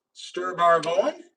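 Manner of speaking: ordinary delivery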